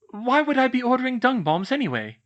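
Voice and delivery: sharp, high demanding question; indignant schoolgirl demanding an answer. Acted. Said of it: "she demanded".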